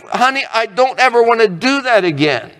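acted-out remorseful promise; pleading husband. Voicing a husband apologising to wife.

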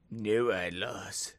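low, slow mock-ominous delivery; hammed-up hushed menace; mock conspiracy voice. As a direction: draw it out like a spooky secret. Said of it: "I have to say like that".

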